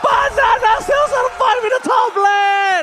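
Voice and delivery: high, loud, drawn-out call; hype shout. Real, not acted. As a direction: build the big moment. Caster hype climax.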